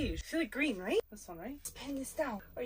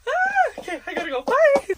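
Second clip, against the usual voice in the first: high, loud squeal and goodbye; squealed sign-off; 12 semitones higher, 8 dB louder, slower than usual. Real, not acted. Woman rushing off to a wedding signs off to viewers, squealing.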